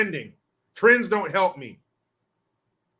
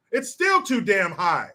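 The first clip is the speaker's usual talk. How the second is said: normal talking voice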